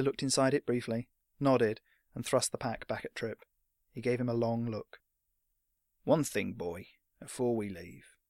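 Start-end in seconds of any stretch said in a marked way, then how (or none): 6.0-8.2 slow, slightly lowered veteran voice; understated gruff fighter; serious old fighter. Acted. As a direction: hold the boy back gravely. Old fighter stops the boy.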